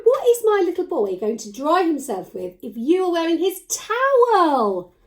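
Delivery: high, loud mock-shocked scolding of a puppet; mock surprise. Teacher playfully telling off the puppet for wearing the towel. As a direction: play scandalised at a cheeky puppet.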